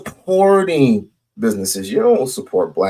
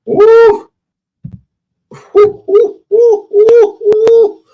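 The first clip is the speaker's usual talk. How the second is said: repeated high whooping woo cries, much louder; high hype woos; 8 dB louder, much slower than usual. Real, not acted. Hyping up the guest after his bold claim, high loud whooping. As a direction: whoop him up like a hype man.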